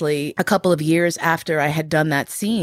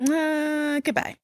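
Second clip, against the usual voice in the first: breezy goodbye; 10 semitones higher, 5 dB quieter, slower than usual. Retold or imitated. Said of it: "choosing to say".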